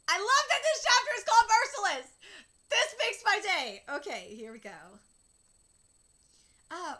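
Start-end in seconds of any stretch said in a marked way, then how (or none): 0.1-3.8 high, loud, laughing excitement; gleeful delight. Real, not acted. Reader loving the chapter title, gleeful. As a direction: crow about it, stretching out the end.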